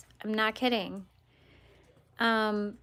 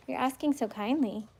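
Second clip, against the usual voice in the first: ordinary talk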